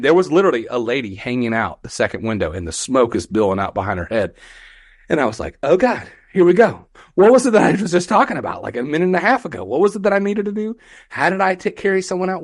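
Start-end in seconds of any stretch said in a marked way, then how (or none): 5.6-12.4 high, fast, flustered self-questioning; panicked inner voice. Retold or imitated. Rookie trying to recall rescue training, mock frantic panic. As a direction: act out racing thoughts, pitched up.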